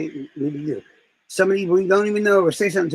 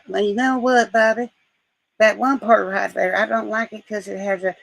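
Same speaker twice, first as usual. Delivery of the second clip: higher, drawling Southern mother; Southern mom; 5 semitones higher than usual. Retold or imitated. Mom handing back her son's novel, Southern accent. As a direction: explain it in a Southern mom's accent, higher than usual. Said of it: "in this weird Southern way".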